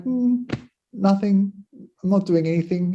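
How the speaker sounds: a hint of a lazy girl brushing it off; lazy maid. Lazy maid answers her mistress.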